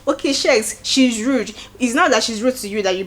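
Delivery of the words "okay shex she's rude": firm, pointed call-out, pitch up; said like you mean it. Shown as a demonstration. Vlogger showing Bella how to call Shex rude, firm and pointed. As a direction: say it flat out and mean it. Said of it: "Say it like you mean it".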